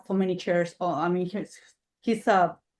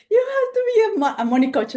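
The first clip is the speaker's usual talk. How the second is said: high squeaky mimic of the lady; mimicking lady; 11 semitones higher, 4 dB louder, faster than usual. Retelling the lady on the call, high mimicry. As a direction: toss her line back in a high voice. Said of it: "this lady's like".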